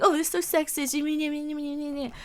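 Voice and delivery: slow sneering parody voice; slow mocking whine; mock whiny quote. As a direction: mimic critics, drawn out. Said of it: "going around saying".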